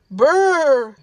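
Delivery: loud shivery brr; shivering brr. A narrator's reading. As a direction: shiver from the cold blast. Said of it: "shivered Mom".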